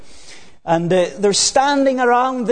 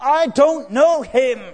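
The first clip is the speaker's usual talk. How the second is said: mild passionate denial; 5 semitones higher than usual. Acted. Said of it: "even with cursing and swearing".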